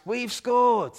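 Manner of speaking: high, loud team cheer; crowd shout. Retold or imitated. Preacher imitating footballers shouting in triumph. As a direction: shout it like a team that just scored. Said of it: "the whole team shouts".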